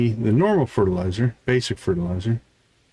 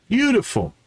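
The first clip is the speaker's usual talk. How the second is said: high, bright burst of delight; 12 semitones higher than usual. Real, not acted. Pleased with the harvest plan, a delighted high exclamation. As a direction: sing out the praise.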